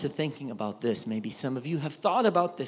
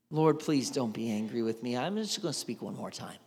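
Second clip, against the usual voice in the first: lower, softer timid asking; humble plea; 5 semitones lower, 5 dB quieter than usual. Acted. Abraham asking one last time, humble and soft. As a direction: ask gently, afraid to push.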